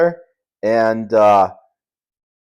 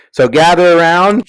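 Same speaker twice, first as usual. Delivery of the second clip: put-on old man storyteller; 6 semitones higher, 8 dB louder than usual. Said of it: "an old man's talking".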